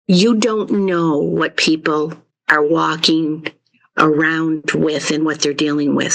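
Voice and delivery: usual speaking voice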